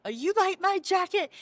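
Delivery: high-pitched excited question; imitating a proud, thrilled man; delighted showing-off. Dawn shows off the donated jacket, delighted. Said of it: "And he goes".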